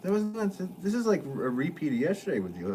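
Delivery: usual speaking voice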